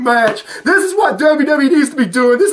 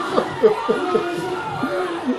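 laughing; 5 semitones higher, 8 dB quieter than usual. Real, not acted. Reacting to wrestling video.

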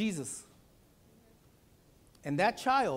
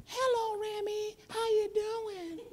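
much higher, slow cartoon toy voice; toy puppet voice; much slower than usual. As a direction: play a little toy greeting a child. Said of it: "I become the iPad".

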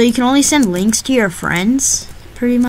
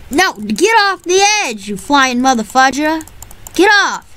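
excited yelling; 7 semitones higher than usual. Real, not acted. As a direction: yell at the enemy. Fighting in game, yelling.

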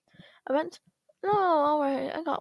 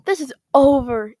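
louder, cutting it short; loud snap; 11 dB louder than usual. Acted. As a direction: shut the argument down. Quarrel ends.

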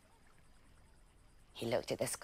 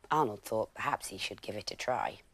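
ordinary delivery

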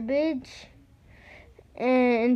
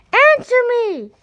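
very high, loud calling across the dump; crying out; 13 semitones higher, 11 dB louder than usual. Acted. Boy calling his fly in the dump, loud high call. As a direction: call far out for a lost pet.

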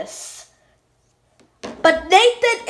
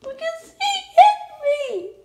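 high crying voice; 11 semitones higher than usual. Pretend. Child crying complaint.

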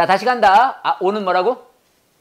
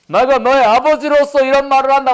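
stern father; 4 semitones higher, 4 dB louder, faster than usual. Acted. Acting a father's line.

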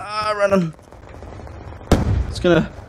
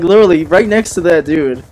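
ordinary delivery

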